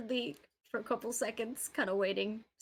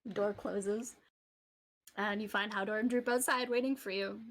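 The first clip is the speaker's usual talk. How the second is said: ordinary talk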